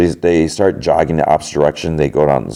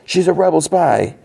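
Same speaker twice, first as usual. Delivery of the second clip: mock yell; 13 semitones higher than usual. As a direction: imitate yelling out an accusation. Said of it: "points at Beskha and yells".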